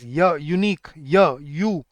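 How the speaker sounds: "य" sounded alone, then "यूनिक" opening on it; isolated y-glide. Shown as a demonstration. Teacher showing students the first sound, "य" isolated before "यूनिक". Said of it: "जब आप यू बोलते हो".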